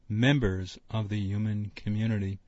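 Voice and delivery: ordinary talk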